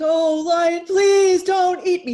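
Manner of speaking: loud, high, drawn-out begging; terrified pleading cub. Acted. Cub begs the huge lion, hammed-up terror. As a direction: plead for your life as a cub. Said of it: "He was terrified".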